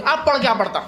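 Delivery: put-on an old man too worked up to hold back; excited old man. Old man scolding the imam mid-prayer, worked up. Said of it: "اتنا جوش میں آ گیا".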